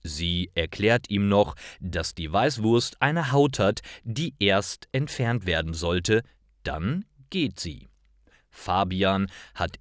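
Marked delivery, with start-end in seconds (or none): none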